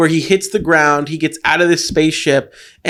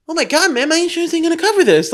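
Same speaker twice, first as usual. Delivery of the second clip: higher, fast whining; mock petty complaint; 9 semitones higher, faster than usual. Guy fussing over insurance after space crash, higher and fast. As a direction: fuss about insurance like a fussy car owner. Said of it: "I wouldn't be like".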